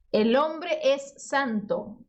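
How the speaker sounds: El hombre es santo, very slow and clear; slow model reading. Shown as a demonstration. Teacher modelling Spanish phrase slowly for students. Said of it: "San vem de".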